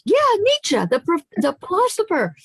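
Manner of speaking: high, eager agreeing Yeah, Nietzsche; impressed interviewer. Retold or imitated. Florida interviewer eagerly agrees with her guest, high and bright. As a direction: gush agreement, pitched high. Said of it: "And she goes".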